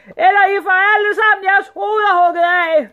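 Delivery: high full-force shouting; screamed threat. A narrator's reading. Sultan threatening to behead his servants, screaming. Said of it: "skreg sultanen".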